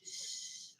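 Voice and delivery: a sharp unvoiced shush to quiet the room. Real, not acted. Teacher shushing the noisy class mid-sentence, sharp unvoiced shh. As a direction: hush them with one breathy shh.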